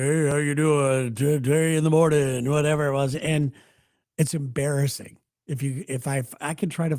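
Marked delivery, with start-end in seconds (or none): 0.0-2.5 radio DJ voice. Retold or imitated. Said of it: "I finally got this voice that would go".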